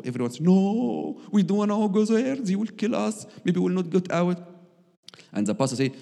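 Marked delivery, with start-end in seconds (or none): 0.4-4.4 high, pleading and scared; retelling a fearful refusal, pitched well higher; frightened pleading. Retold or imitated. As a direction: beg them not to make you go. People afraid to go to the villa.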